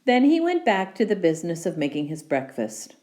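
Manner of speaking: ordinary delivery, nothing marked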